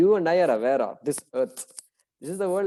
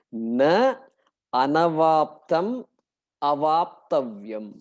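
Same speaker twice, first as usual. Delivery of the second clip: slow, each word set apart with pauses; split carefully; 3 dB louder, slower than usual. Shown as a demonstration. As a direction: break "Na anavaptam avaptavyam" into its words, slowly. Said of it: "Okay, split carefully".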